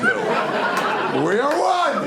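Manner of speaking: drawn-out, high, rising yell; hammed-up slow yell of unity; stretched romantic yell. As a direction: bellow it slowly like a grand climax. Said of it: "Just yell".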